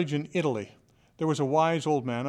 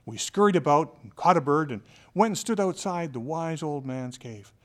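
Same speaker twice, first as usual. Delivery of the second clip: normal talking voice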